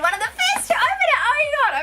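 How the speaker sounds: high, fast squeal of delight; excited squeal. Real, not acted. Thrilled to open the box first, squealing with delight.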